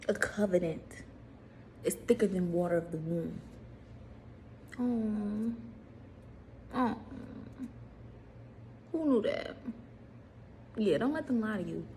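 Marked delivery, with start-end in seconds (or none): none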